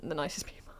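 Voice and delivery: hushed, not meant to be overheard; gossipy whisper. Real, not acted. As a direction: drop to a whisper on the insult. Whispered gossip about rivals.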